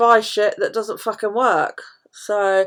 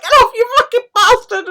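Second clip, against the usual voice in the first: much higher, louder laughing yell; shout; 10 dB louder than usual. Retold or imitated. Telling the physio to get off, laughing yell. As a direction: yell at him while helpless with laughter. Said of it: "And I'm like".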